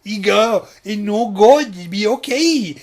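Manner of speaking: loud, high simpleton with broken grammar; silly put-on voice. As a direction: ham up a naive, hopeful fat boy. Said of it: "Why am I speaking like this in my own memory".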